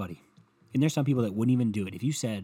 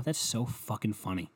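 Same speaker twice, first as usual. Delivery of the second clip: usual speaking voice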